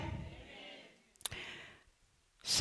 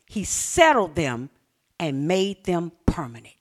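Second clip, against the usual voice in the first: ordinary talk, nothing marked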